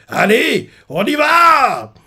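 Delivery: high, loud, slow battle cry; rallying shout. Retold or imitated. Billet ordering his troops forward, shouting. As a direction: send the men charging. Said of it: "Billet leur fait".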